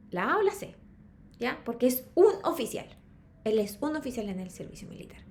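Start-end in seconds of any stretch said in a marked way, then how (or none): 2.2-2.9 UN punched louder than the rest; stress on UN. Shown as a demonstration. Teacher explaining the needed article, stress on UN. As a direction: hit UN hard. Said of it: "Porque a y an significan lo mismo".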